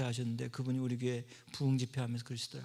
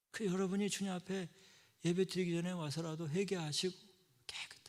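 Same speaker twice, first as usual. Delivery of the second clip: ordinary delivery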